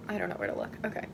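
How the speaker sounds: ordinary talk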